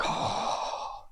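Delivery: long, airy gasp of dread; freaked-out gasp. Retold or imitated. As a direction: suck in breath in rising panic. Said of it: "you start freaking out".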